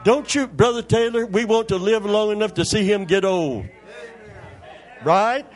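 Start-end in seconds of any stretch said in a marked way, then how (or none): none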